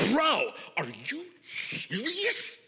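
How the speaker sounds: slow disbelieving shout; outraged disbelief at the email; incredulous. Retold or imitated. Reader reacting to angry email, incredulous shout. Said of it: "hot and bothered".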